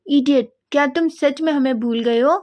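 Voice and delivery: a high, loud yell down the phone; loud shout. Acted. Sister yelling at Wang Ji on the phone, loud shout. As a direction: shout it at him like he forgot you. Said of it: "एक ज़ोर की आवाज़ आती है".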